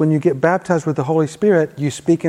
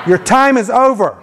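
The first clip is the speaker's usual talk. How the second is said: higher-pitched, emphatic faith declaration; like you mean it; 5 semitones higher than usual. Shown as a demonstration. Preacher leads congregation's repeat-after-me prayer, emphatic and meaning it. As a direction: proclaim it with conviction for others to echo. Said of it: "say it like you mean it".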